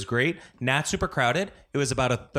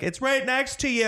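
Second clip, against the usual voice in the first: high mock neighbor; 12 semitones higher than usual. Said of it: "he's like".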